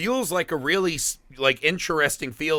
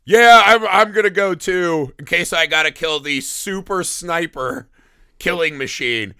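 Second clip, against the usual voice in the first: mock eager shout; 3 semitones higher, 7 dB louder than usual. Voicing Sigourney Weaver's character.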